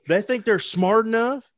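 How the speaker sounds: mock billionaire boast. Friend plays a hedge fund billionaire bragging about shorting, in a high mock voice. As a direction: lampoon a smug billionaire bragging.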